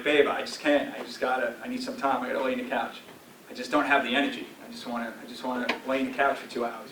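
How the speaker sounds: tired sigh of a voice; worn-out plea. Retold or imitated. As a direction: sink into it like you can't get up off the couch. Tired plea to wife about couch.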